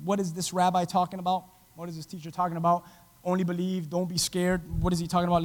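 acted thinking aloud, quietly baffled; puzzled self-questioning. Jairus puzzles to himself over the rabbi's words, quietly baffled. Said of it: "He's probably puzzled, asking himself".

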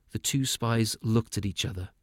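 plain reading voice

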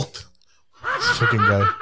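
slow and high with laughter; laughing through the words. Real, not acted. Mocking friend, laughing.

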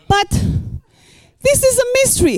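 high, slow, eerie mystery voice; mock mysterious. Preacher teasing the congregation about a mystery, eerie and high. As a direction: draw it out high like a spooky secret.

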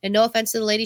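usual speaking voice

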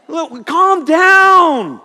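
long, drawn-out high shout; exasperated shout. Acted. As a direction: comically plead with them, stretching the words out. Mock scolding of angry shoppers.

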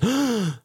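a quick high shocked intake of breath; sharp gasp. Retold or imitated. Wife gasping as the cable gets cut, shocked gasp. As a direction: gasp like you just saw the cable get cut. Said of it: "And she goes".

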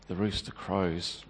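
the rooster crows said soft, low and slow; broken whisper-soft echo. Acted. Peter repeating the omen to himself, hushed. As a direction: let "the rooster crows" sink away in despair.

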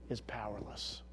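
soft whispered punchline; whispered reveal. Acted. Preacher revealing the powerless wizard, in a whisper. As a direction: drop to a hush on the reveal.

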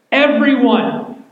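Everyone stretched slow and loud; stress on Everyone. Acted. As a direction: hit Everyone like it's in all caps. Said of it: "in all caps".

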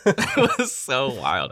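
laughing through the words, slow. Real, not acted. Telling her co-host about the clip, laughing. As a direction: still cracking up.

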